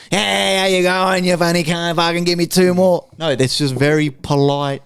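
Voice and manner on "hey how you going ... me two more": rowdy Aussie larrikin. Retold or imitated. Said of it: "even the dudes that are having a drink, it's not".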